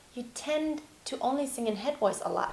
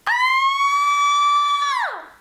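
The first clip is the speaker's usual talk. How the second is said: a loud, very high shout of Ah; high head-voice scream; 9 dB louder than usual. Shown as a demonstration. Teacher demonstrating a head-voice scream. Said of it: "That's really head voice".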